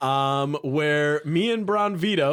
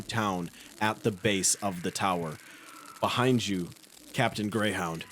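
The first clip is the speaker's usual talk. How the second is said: scene setting; 7 semitones lower, 4 dB quieter than usual. Acted. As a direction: paint the scene gravely. Game master scene setting.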